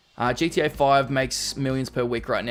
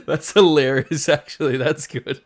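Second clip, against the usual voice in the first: laughing; 2 semitones higher, 5 dB louder than usual. Real, not acted. Reacting to clip.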